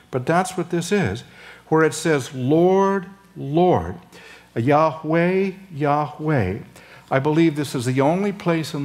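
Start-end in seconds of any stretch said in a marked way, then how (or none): 2.3-6.6 each name spaced and weighty; solemn proclamation. Shown as a demonstration. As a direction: proclaim it like a herald. Proclaiming the doubled divine name.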